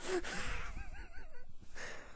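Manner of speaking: real high, breaking-up giggle; helpless laugh at the performance. Real, not acted. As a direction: crack up after your own shouting. Reader cracks up after shouting.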